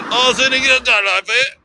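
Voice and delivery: high-pitched garbled Manchester cabbie; mock Manchester accent. Cab driver muttering directions at passenger, mock Manchester accent. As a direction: imitate a thick, incomprehensible Manchester accent. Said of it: "maybe it was a Manchester accent".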